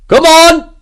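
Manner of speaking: high, full-force battle roar. A narrator's reading. Sergeant urging men up the breach, roaring. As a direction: roar to drag the men forward. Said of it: "Sergeant Graham roared".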